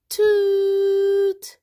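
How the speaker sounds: long, very high, loud honk; truck horn toot. Acted. Father's truck horn imitated.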